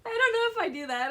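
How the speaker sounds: high, loud words broken by laughter; real giggly protest; laughing while talking. Real, not acted. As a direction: squeak it out through laughter. Laughing at seal clap joke.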